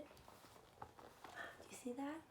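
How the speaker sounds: slow breathy hush; whisper. Acted. Reader whispering to children over the peaceful picture. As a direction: whisper it very slowly.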